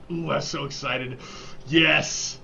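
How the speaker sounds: excited outburst, put on for a joke; mock excitement. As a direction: play at being so excited. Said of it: "That was like".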